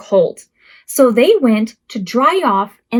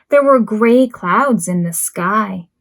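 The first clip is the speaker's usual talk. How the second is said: ordinary delivery, nothing marked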